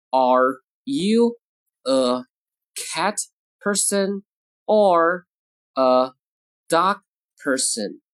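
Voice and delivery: each word separate, with a pause after it; word-by-word model. Shown as a demonstration. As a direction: dictate it slowly, one word at a time. Teacher models sentence word by word.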